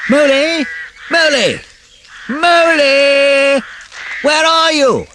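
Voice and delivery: loud, high, drawn-out calls through the woods; calling out. A narrator's reading. Rat calling for the lost mole in the woods, loud. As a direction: shout for a lost friend in the dark. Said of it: "all the time calling out".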